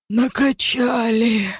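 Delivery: slow, high, strained breathless cry; out of breath. A narrator's reading. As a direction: squeeze the word out on a gasp. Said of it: "Демон пытался дышать".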